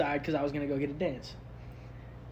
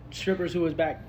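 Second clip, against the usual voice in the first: ordinary talk, nothing marked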